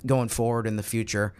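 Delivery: usual speaking voice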